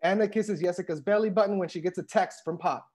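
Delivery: ordinary delivery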